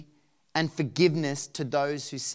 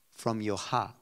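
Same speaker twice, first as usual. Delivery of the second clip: "From your heart" repeated low and soft, set apart by pauses; emphasis by repetition; 6 semitones lower, 5 dB quieter than usual. Real, not acted. Preacher repeating the key words of scripture, soft solemn emphasis. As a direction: let "From your heart" land quietly. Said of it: "That's the emphasis".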